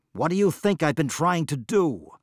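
high, loud, exasperated blurt. Acted. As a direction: throw the question back at him. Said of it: "blurted Mabon".

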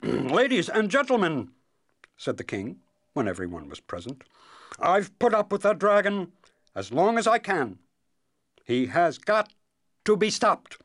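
proclaiming king. Acted. King addressing the assembled kingdom about the dragon, proclaiming. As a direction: clear the throat and proclaim like a king.

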